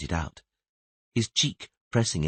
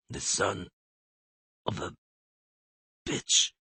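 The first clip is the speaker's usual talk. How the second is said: grunt; 6 dB quieter than usual. Acted. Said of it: "he grunted".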